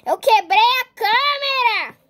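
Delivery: slow, loud distraught admission; loud distraught confession; dismayed. Acted. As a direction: confess the broken camera in dismay. Camera broken.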